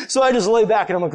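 normal talking voice

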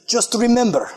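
high, hammed-up Spanish-accented battle cry; mock-heroic Spanish accent, climbing high. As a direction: imitate a rousing pep talk in broken Spanish-accented English, building to a drawn-out yell. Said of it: "try to do it in her accent".

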